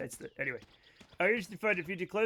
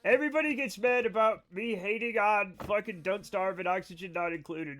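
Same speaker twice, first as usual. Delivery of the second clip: ordinary talk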